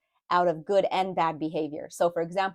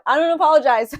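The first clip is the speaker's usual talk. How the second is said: higher, much louder, laughing off the apology; emphatic retraction; 7 semitones higher, 9 dB louder than usual. Real, not acted. Reader takes back her apology for crying, loud and emphatic. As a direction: belt it out, defiant through the tears.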